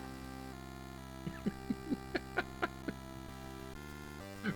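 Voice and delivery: long, breathy giggles; helpless laughing fit. Real, not acted. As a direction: try and fail to stop laughing. Cracking up about the pig.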